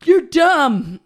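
high, loud mock kid yell; hammed-up childish outburst; childish insult. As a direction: hurl it like a child barred from swearing. Childish insult, reconstructing what he said.

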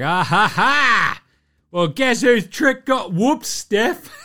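loud, high taunting laugh and jeer; mock gloating. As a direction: crow over them, imitating gleeful fans. Mimics everyone gloating at Steph.

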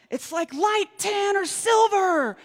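high, slow shouted reply; husband shouting back the details. Retold or imitated. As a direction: call back across the dark. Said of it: "he shouts back".

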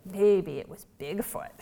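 put-on slow, musing little-girl guess; lightly voiced child. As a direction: ponder it like a small child. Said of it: "she said".

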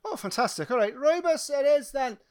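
high, projected ride attendant voice; enthusiastic teacup attendant. Acted. Attendant taking the riders' tea order, high and enthusiastic.

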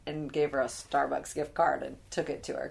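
normal talking voice

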